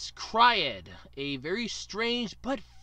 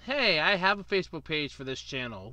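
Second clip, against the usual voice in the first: normal talking voice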